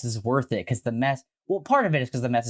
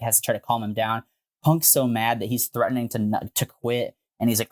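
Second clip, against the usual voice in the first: usual speaking voice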